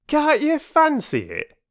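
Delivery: very high, loud, slow indignant porter. Acted. Perks to the children, indignant. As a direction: scoff in outrage. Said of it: "said Perks indignantly".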